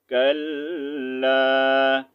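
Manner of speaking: ordinary talk, nothing marked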